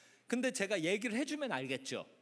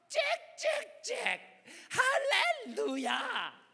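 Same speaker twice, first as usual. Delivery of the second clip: very high, slow, drawn-out chirpy praise; chirping like birds; 11 semitones higher, 4 dB louder, much slower than usual. Acted. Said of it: "새들이 노래하기 전에 내가 먼저".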